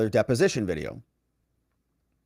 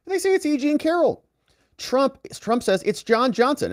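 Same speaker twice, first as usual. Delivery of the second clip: excited retelling; 13 semitones higher than usual. Real, not acted. Retelling the wrong-woman mixup.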